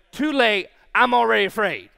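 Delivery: loud mock protest; exasperated fearful comeback; panicked retort. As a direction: insist on it loudly, comically scared. Repeats his panicked retort.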